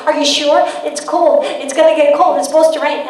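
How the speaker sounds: insistent mock fretting, piling up warnings; acted parental nagging. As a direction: imitate a worried mom pressing the point. Worried parent urging a coat.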